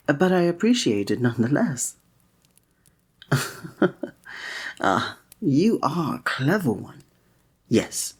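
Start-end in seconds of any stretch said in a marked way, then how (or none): 3.3-4.1 a quick, airy chuckle; short light laugh. Real, not acted. Charmed by the listener's clever guess, a quick light laugh. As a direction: laugh lightly, amused by them.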